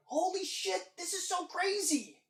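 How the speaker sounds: high, amazed exclaim. Retold or imitated. Viewer at the tie-in reveal, high amazed exclaim. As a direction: gasp it out thrilled. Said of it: "you're just like".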